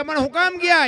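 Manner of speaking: husband alarmed that the guests have gone; shocked question. Retold or imitated. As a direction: demand to know why they left.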